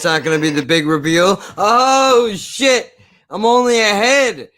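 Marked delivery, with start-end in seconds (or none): 1.6-4.5 high, loud hammed-up horror; mock shocked shriek. Mocking the movie's twist with a loud, high fake shriek. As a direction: scream in fake shock at the severed head.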